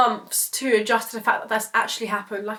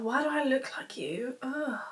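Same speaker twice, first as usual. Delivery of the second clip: much softer, breathy whispered disgust; 11 dB quieter than usual. Retold or imitated. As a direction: whisper it in revulsion. Disgusted inner reaction, whispered.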